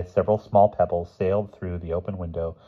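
usual speaking voice

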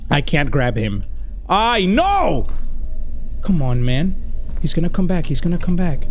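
ordinary talk, nothing marked

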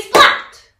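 big shouted splat; very loud splat. Shown as a demonstration. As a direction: slam it like catching a fly. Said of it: "So let's try that, shall we?".

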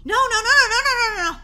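high, loud string of noes; frantic denial. Acted. Girl correcting her sister about detention, frantic denial. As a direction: rattle them out in a panic.

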